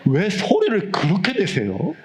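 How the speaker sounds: mock annoyed teacher. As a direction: imitate her annoyance with a laugh. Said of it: "자꾸 저한테 성질을 내는 거예요". (웃으며 흉내 낸 짜증)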